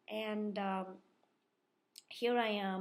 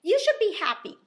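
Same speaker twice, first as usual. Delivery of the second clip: mock opponent's exclamation; 10 semitones higher, 14 dB louder than usual. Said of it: "And then she goes".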